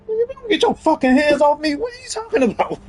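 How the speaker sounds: high-pitched mock outrage; indignant, voice leaping way up in disbelief; indignant outburst. Imagined reply to being questioned over his accent, indignant.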